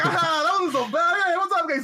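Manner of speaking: high-pitched, giddy wrestler impression; mock-cheerful, greeting the crowd through laughter; super happy, excited. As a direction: act out an over-excited entrance. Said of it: "super happy and excited to be there".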